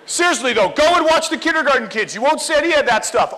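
ordinary talk, nothing marked

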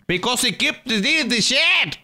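high-pitched exasperated complaint; upset whine. Real, not acted. Streamer explaining to partner about deleted settings, high upset whine. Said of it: "Why are you so upset?".

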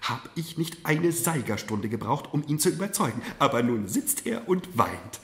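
lightly voiced mother, secretly pleased; amused mother. Mother tells of father's tears.

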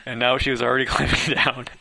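reader cracking up mid-sentence; laughing. Real, not acted. Reader loses composure laughing while reading aloud. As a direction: giggles breaking through the narration.

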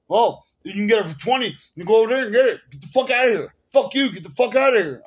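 loud, high, in-your-face brush-off of a customer; blunt aggressive yell. Retold or imitated. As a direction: bark it out like a pushy salesman telling people off. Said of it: "He, like, literally tells people to fuck off".

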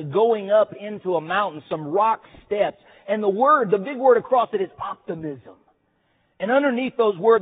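ordinary talk, nothing marked

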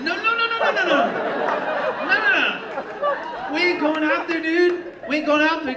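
high, loud frantic pleading; panicked refusal. Retold or imitated.

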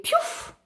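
breathy "piouf" vanishing noise; whooshing poof. A narrator's reading. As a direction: make a magic whoosh of it. Vanishing sound effect in the story.

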